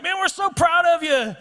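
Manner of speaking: high, drawn-out proud cheer; cheering supporter. Acted. Crowd encouraging runners at the start of the race, cheering. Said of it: "When the cheering stops".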